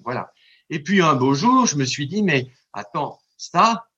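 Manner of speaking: usual speaking voice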